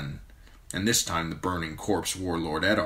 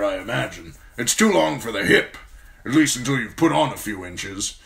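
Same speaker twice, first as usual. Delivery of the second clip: old commander voiced higher and louder, blunt and forceful; a gruff old commander praising a young man; gruff old lord commander; 6 semitones higher, 6 dB louder than usual. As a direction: speak as the stern old Lord Commander, grateful but brusque. Mormont voice.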